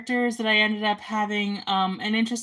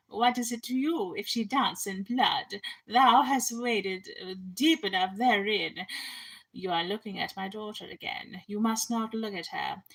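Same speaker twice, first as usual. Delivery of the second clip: lightly voiced haughty queen, a hint of Sharon Osbourne; a touch of a cold stepmother queen, scolding her husband; Herodias voice; 4 dB quieter than usual. Queen scolds her husband about her daughter, mild sneering voice. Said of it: "where does that voice come from".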